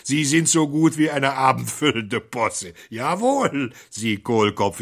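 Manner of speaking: mock him with delight; gleeful mockery. The thief gloats over the fooled priest, gleeful high mockery. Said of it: "jauchzte vor Vergnügen".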